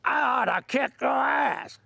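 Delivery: high, slow mock holler; angry old-timer shouting a challenge; retold holler. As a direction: bark it like a threat at a stranger. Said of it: "hollers at me".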